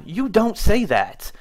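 indignant slow scold. Real, not acted. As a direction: lay down the rule slowly. Scolding the tech's remark.

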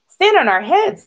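high, lively cut-in; eager interruption. A narrator's reading. Thistle cuts off Goose's plea, eagerly interrupting. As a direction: jump in brightly over someone, pitched high. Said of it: "Thistle interrupted".

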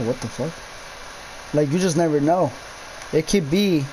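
usual speaking voice

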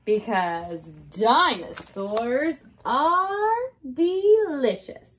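slowed, drawn-out spooky finish; slow ominous ending. Acted. Teacher ends story for class, slow and teasing. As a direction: stretch it out with relish.

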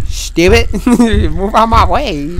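usual speaking voice

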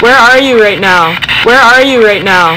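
loud repeated calls to a spirit; calling out loud. Real, not acted. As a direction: shout into the basement.

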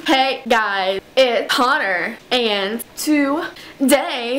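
very slow, drawn-out vlogger intro; Connor Franta. Acted. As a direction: stretch each word of the greeting. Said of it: "know how to impersonate him".

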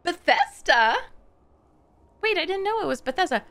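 high-pitched surprised double take. Real, not acted. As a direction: react with sudden, high surprise. Learns the studio while watching.

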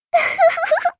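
a bright high laugh; short high amused laugh. Acted. Character laughs.

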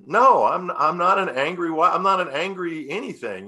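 emphatic mock-defensive comeback; retold protest. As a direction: re-enact protesting your innocence to your wife. Retelling his protest to wife.

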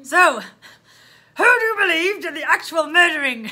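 loud, slow interrogation; booming officer on the verge of laughing; loud, drawn-out police question; policeman. Acted. Policeman interrogating, reader tickled.

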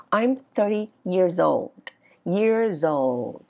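put-on slow, stretched "years old", sliding down; falling statement tune. Shown as a demonstration. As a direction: let "old" fall away at the end. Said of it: "thì nó sẽ đi xuống". ("old" xuống giọng, đọc chậm)

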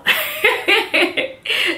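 breathy giggle, high and airless; real laugh. Real, not acted. Laughs at her own example.

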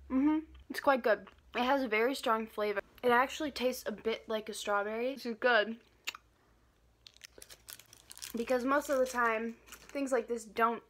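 candy in cheek while speaking; talking around hard candy. Real, not acted. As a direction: mouth partly full, sucking. Seen 0: candy put in at -1.0, cheeks bulge while talking.

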